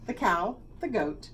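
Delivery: ordinary delivery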